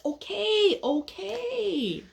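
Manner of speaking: high, drawn-out giving in; chastened impatient caterpillar. Acted. Caterpillar gives in after being shushed, grudging. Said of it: "So the impatient caterpillar says".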